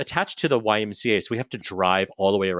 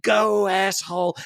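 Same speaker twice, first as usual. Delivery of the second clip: mock angry driver; 9 semitones higher, slower than usual. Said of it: "she's like".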